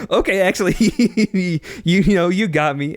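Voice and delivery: mock surrender. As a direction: cave in sheepishly. Defeated villain gives up.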